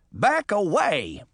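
high, slow warning; commanding shout. A narrator's reading. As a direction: order him off, drawing the words out. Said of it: "Hampstead shouted".

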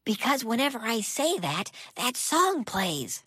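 whisper. Acted. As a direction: keep it a secret whisper. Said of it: "why are you whispering?".

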